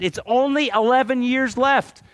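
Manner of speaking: high, gleeful countdown to the pension; imitating excited friend. Speaker voices his pension-counting friend, excited. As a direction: play the friend thrilled about his pension. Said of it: "But he's so excited".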